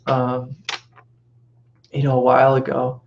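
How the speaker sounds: ordinary talk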